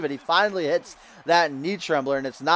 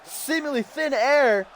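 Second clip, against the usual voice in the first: excited shout; 14 semitones higher than usual. Real, not acted. Commentator spotting a surprise entrance, shouting excitedly high. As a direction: yell it as the run-in happens. Surprise run-in during the match.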